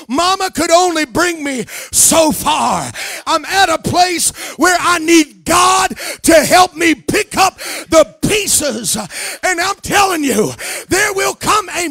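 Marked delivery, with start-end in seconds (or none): none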